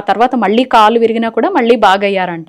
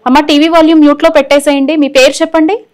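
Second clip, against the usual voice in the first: raised, high-pitched instruction; loud and high, to get through on the phone; calling out to caller; 7 semitones higher, 8 dB louder than usual. Real, not acted. Host tells caller to mute the TV, calling out loudly.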